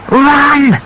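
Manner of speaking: high, loud urgent yell; urgent cry to run. A narrator's reading. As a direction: shout it to your sister as you bolt. Kids fleeing the angry guard.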